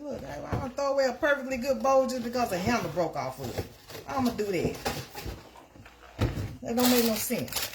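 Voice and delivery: high indignant retort; mock indignant. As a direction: refuse to throw it out, high and sassy. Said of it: "I was like".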